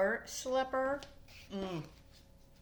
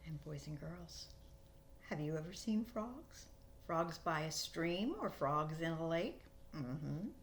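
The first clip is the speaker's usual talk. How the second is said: put-on soft, lower aside to the kids; reading to children; 4 semitones lower, 10 dB quieter than usual. A narrator's reading. Storyteller turning to the children, soft and low. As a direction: lean in and ask the children gently.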